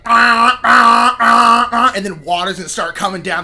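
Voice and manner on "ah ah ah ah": loud pained cries, high, in short bursts; hammed-up yells of someone hitting concrete; mock screams of pain. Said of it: "it's gonna be like".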